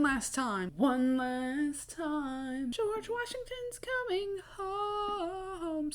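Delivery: slow, drawn-out dramatic voice; slow it down, then go very high and soft; dramatic announcer. Pretend. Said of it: "dramatic buildup bit".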